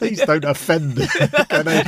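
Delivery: amused protest with a laugh; laughing plea. Real, not acted. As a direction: plead through real laughter. Laughing plea after accent joke.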